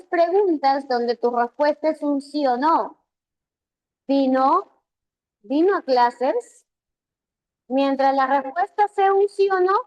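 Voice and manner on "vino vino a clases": "¿Vino? ¿Vino a clases?" with pitch climbing at each end; rising intonation. Shown as a demonstration. Teacher models yes-no questions, intonation rising at the end. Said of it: "la entonación sube al final".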